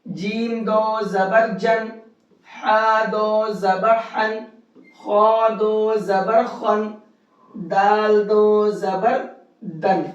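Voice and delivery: slow: جن، ہن، خن، دن each ending in a nasal ن; slow drill for students; slow tanween drill. Shown as a demonstration. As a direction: spell each one slowly and ring the ن. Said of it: "اسی طرح سے پڑھنا ہے".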